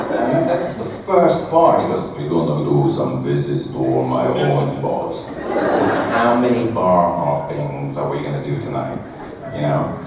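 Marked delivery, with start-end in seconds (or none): 2.2-9.0 a lightly lowered giant's voice; a hint of a big friendly giant; low, slightly louder giant planning the night; Andre impression.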